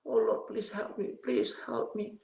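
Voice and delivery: slow, high-pitched plea for help; tearful pleading, re-enacted. Retelling his cry to God for help, tearful begging. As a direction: beg as if weeping, without raising volume. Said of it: "Saya menangis, menangis".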